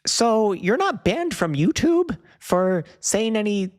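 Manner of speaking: high, slow mock-mom voice; imitating mom. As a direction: squeak out mom's fumbling question. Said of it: "She said".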